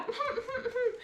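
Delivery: soft laugh. Real, not acted. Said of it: "laughs at her own act".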